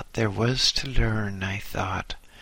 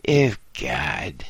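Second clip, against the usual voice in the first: whispered weary sigh, lower; 7 semitones lower than usual. Acted. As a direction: sigh it out, fed up. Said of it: "she sighed wearily".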